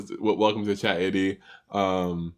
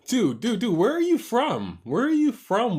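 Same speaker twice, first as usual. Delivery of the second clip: much higher, louder amused outrage; startled disbelief; 13 semitones higher, 4 dB louder than usual. Real, not acted. Streamer reacts to being called boy, high and incredulous. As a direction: yelp in laughing disbelief at the insult.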